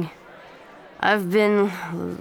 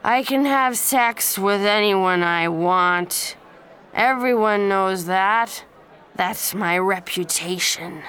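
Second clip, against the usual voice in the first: smug boasting; 3 dB louder than usual. Acted. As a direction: gloat about his conquests. Smug drunk boy boasts.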